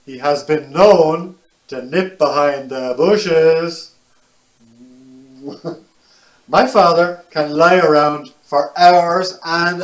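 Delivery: put-on lively, animated read to children; picture book reading. A narrator's reading. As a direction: tell it brightly to little listeners.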